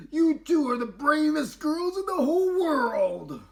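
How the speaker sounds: high, loud proud praise; joyful. Acted. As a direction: beam at the girls. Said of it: "Joyfully, Mama and Papa remarked".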